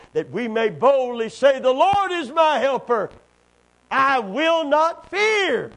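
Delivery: rising loud and high, slowing at the end; preached scripture reading. Acted.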